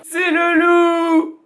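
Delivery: cri très aigu et fort, traînant; cri d'enfants. A narrator's reading. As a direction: hurler de panique. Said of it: "hurlent les enfants". (les enfants hurlent, cri aigu)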